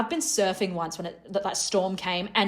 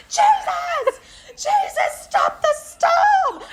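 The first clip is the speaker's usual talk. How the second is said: high, loud, frantic pleading; panicked scream; 4 dB louder, much slower than usual. Retold or imitated. Retelling her screamed prayer in the storm, high panicked cry. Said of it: "I'm screaming".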